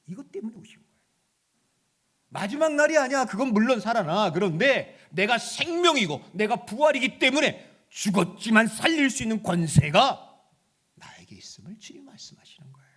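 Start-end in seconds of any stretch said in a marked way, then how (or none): 2.3-10.4 loud, ringing preacher's proclamation; full-force preaching. Acted. Preacher declaring to the congregation, full force.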